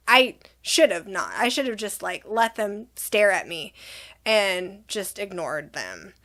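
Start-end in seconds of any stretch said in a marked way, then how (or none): none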